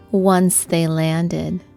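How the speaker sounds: ordinary delivery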